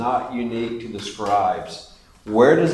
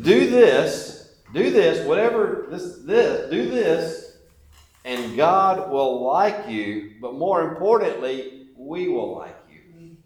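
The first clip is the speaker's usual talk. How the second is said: higher, insistent mock commands, listing rules; imitating Pharisees; 4 semitones higher, slower than usual. Preacher voicing the Pharisees laying down rules, mock bossy. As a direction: rattle off the rules like a bossy rule-keeper. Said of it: "this is how they would maybe have said it".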